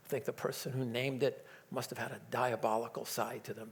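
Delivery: ordinary delivery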